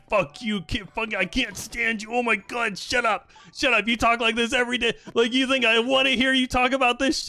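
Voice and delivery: put-on high, fed-up telling off of a kid; mock mean rant. Pretending to rant at a kid, high and mock-annoyed. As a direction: snap at the kid in exaggerated disgust. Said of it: "I was like".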